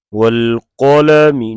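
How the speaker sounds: वल कलमि joined smoothly with a stretched कलमि; slow demo of वल कलमि read as one; joined recitation demo. Shown as a demonstration. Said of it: "तो ऐसे इसको मिला के पढ़ेंगे".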